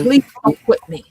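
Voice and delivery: real emotion creeping into a plea; getting emotional. Real, not acted. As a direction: plead as the emotion rises. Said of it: "I'm getting emotional now".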